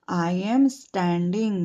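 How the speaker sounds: very slow, each word drawn out; slow model phrase. Shown as a demonstration. Teacher modelling the phrase slowly for children to repeat. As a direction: say it slowly for pupils to copy. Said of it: "I stand नहीं आएगा यहाँ पर".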